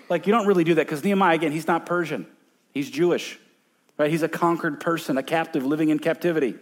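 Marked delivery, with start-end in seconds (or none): none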